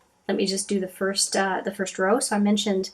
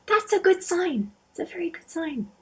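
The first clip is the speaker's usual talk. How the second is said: delighted amusement; 9 semitones higher than usual. Real, not acted. Vlogger tempted to apply the shadow, amused and high. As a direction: say it through a little laugh, much higher.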